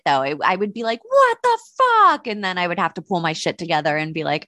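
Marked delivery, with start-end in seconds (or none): 1.0-2.3 high, slow, stretched-out shocked cry; shocked what the fuck. Retold or imitated. Imagining a glitter bomb going off, high drawn-out shocked cry. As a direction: drag out the disbelief, way up high.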